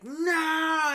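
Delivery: high, loud anguished no; anguished cry. Retold or imitated. Cop sees friend dying.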